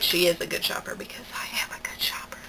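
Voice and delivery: unvoiced whispered aside; hushed self-praise whisper. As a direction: breathe it out as a secret. Whispered aside about herself.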